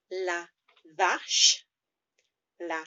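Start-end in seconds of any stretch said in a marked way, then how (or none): 1.0-1.6 vache said slowly and loud, rising; clear French vache. Shown as a demonstration. Teacher modelling vache for the class, loud and clear. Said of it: "V H".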